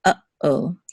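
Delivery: uh-oh split by a short silence; pause between syllables. Shown as a demonstration. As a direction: stop after "uh", then say "oh". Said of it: "there's a pause between uh".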